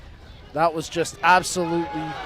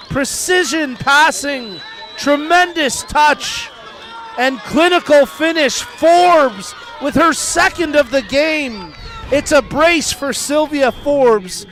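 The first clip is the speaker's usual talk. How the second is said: excited; 7 semitones higher, 6 dB louder, slower than usual. Real, not acted. Commentator praises the goal after.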